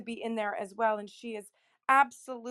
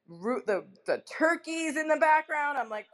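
normal talking voice

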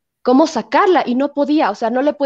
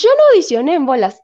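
louder Argentine accent imitation, raised; 5 semitones higher, 6 dB louder than usual. [name] retelling Liliana's line in an Argentine accent. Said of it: "con su acento argentino".

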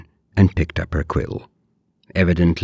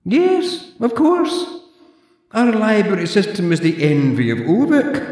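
put-on high, prim old librarian voice; haughty zombie librarian, clipped and proper; librarian Deirdre; 5 dB louder than usual. Deirdre lectures the borrower in a high prim voice.

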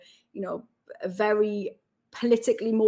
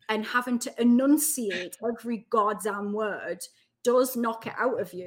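normal talking voice

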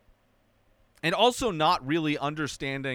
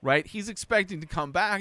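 ordinary talk

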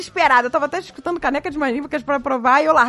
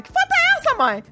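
put-on high, laughing squeal of a shocked kid; mock shocked child. Mother imitating her son finding the photo, high squeal. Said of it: "Aí ele".

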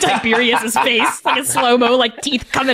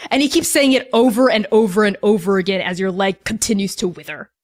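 normal talking voice

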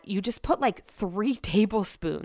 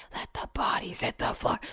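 "Let the bodies hit the floor" whispered, voiceless and much softer; 8 dB quieter than usual. Retold or imitated. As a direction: breathe the lyric under your breath. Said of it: "'cause I whispered".

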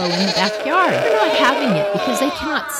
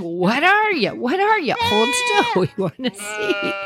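eager sheep voice; 4 semitones higher than usual. Pretend. Voicing curious sheep.

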